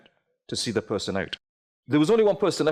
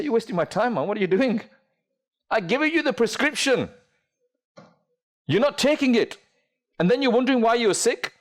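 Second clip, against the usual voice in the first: higher, scolding the careless patient; exasperated doctor; 6 semitones higher than usual. Acted. Doctor scolding a patient who ignored the prescription, higher and exasperated.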